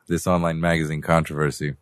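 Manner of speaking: usual speaking voice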